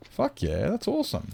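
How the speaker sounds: very high delighted squeal; high-pitched thrilled cheer; excited squeal. Real, not acted. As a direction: squeal it with real joy. Excited reaction to good news.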